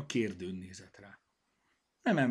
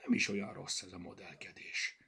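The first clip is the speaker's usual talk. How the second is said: ordinary delivery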